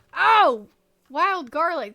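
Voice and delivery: loud sudden "oh" of discovery; surprised exclamation. Real, not acted. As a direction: blurt it out startled. Sudden find in game.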